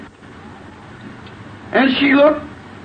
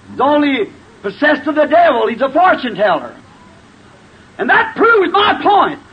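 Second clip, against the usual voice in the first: high, indignant accusation; red-faced anger; 5 semitones higher than usual. Acted. Simon denouncing the visitor as a fortune teller, indignant. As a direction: press the charge with rising, hammed-up outrage. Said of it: "red in the face".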